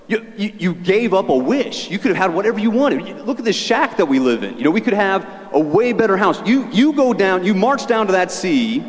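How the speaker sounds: a hint of a scolding wife pushing her husband; demanding wife. Wife sending fisherman back to the fish, pushy high nagging.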